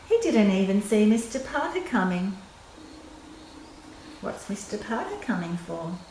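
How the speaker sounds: ordinary delivery, nothing marked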